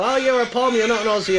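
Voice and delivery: high, loud mock outrage at an Aussie; mocking accuser. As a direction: squeal it like people who call him a pom. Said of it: "People go".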